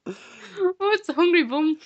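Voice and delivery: high put-on Irish lilt; mock Irish accent. Co-host teased in a mock Irish accent.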